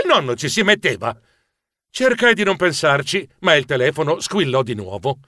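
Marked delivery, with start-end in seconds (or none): none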